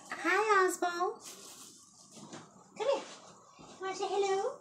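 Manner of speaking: high, fast sing-song pet voice. Real, not acted. Greeting her dog Oswald for the viewers, high baby talk.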